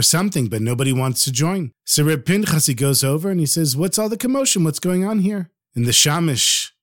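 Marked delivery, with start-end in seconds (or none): 3.8-5.5 higher-pitched puzzled query; puzzled questioning. Acted. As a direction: ask what the fuss is about. Rabbi asks about a commotion.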